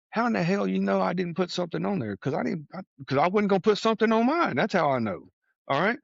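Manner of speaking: high, disbelieving outburst, pitch way up; incredulous retort. Retold or imitated. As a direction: play the caught-out newcomer, amazed. Sponsee stunned at being caught out.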